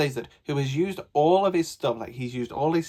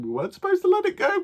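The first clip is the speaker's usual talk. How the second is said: very high, louder rushed worry; alarmed; 4 dB louder, faster than usual. Real, not acted. A reader realizing the twist, very high alarmed squeak.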